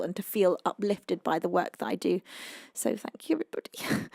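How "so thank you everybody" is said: soft whisper, barely voiced; whispered thanks. Real, not acted. Streamer whispers thanks to her viewers. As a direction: breathe the thanks out.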